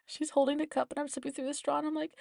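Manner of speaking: normal talking voice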